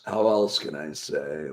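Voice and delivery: ordinary talk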